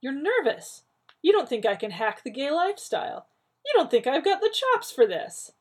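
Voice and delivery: rising high and loud with realization; eager, building excitement; sudden understanding. A narrator's reading. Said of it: "with sudden understanding".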